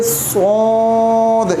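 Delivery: सोद drawn out long and heavy; long, thick सोद; heavy lengthened letter. Shown as a demonstration. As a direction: stretch सोद with a full, heavy sound. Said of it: "ये मोटा हरफ है".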